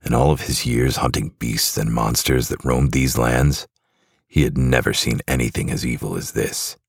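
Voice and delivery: plain reading voice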